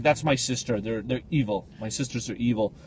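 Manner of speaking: soft, low conspiratorial aside; hushed mock confession; low confiding. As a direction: lean in and tell her quietly. Confiding to stewardess.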